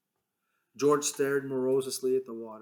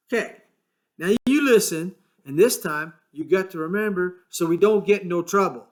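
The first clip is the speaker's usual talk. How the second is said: impatient George, higher; brusque retelling; George's voice; 6 semitones higher, 6 dB louder than usual. A narrator's reading. George making Lenny listen, impatient and higher.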